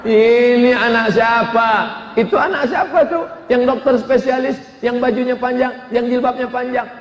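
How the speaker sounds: admiring exclamation. Retold or imitated. People admiring the pious doctor, high and impressed. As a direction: ask in delighted admiration.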